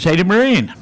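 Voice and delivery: loud call across to the enemy; enemy soldier hailing the Marine. Retold or imitated. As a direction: shout it over the rocks. Enemy soldier calling across lines.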